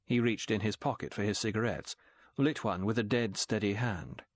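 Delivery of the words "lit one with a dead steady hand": ordinary delivery, nothing marked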